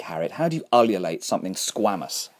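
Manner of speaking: ordinary delivery, nothing marked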